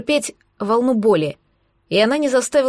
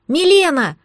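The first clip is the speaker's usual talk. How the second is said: higher-pitched call into the distance; yelling; 5 semitones higher, 4 dB louder than usual. A narrator's reading. Girl calls to Milena below the cliff, yelling. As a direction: yell out the name. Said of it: "заорала я".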